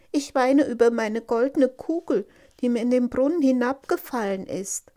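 high, loud princess voice; upset princess. Acted. (klagende Prinzessin, hoch und laut)

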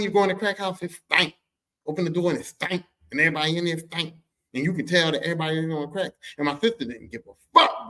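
ordinary talk

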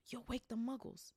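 a lightly voiced stern witch; McGonagall hissing. A narrator's reading. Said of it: "hissed Professor McGonagall".

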